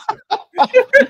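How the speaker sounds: squealing laugh; high laugh. Real, not acted. Laughing at own accent.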